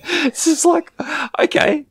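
high, breaking up mid-story; real laughter. Real, not acted. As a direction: crack up while retelling the boast. Cracking up at the retold boast.

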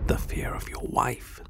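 hushed fearful whisper; barely voiced, afraid to be overheard. Afraid to say it aloud.

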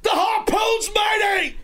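pirate shout. Pretend. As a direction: bellow it like a pirate captain. Pirate banter with caller.

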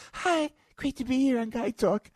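very high, breathy-light celebrity guest voice. Retold or imitated. Guest on the talk show in a very high pop-star voice. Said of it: "Who was [name]? [name]".

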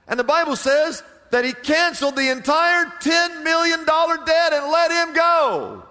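rising preacher's amazement at a cancelled debt; amazed preaching. Real, not acted. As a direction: lift it up in wonder at the mercy. Marvelling at the king's mercy.

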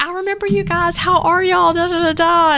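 loud, high-pitched retold hello; hearty greeting, imitated. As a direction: greet old acquaintances with gushing enthusiasm, as an imitation. Retells the celebrity's hearty greeting.

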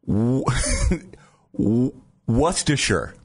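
false starts on W-, then Worcestershire as WUSS-tuh-sher; struggling to pronounce. Shown as a demonstration. Host braves a hard word, stumbling into Worcestershire. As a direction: stall on the W, laugh, then get out Worcestershire. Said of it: "actually get the word out".

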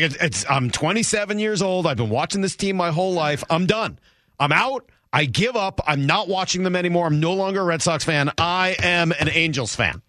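high, exasperated fan rant; fed-up fan. Retold or imitated. Friend Mike quitting Red Sox.

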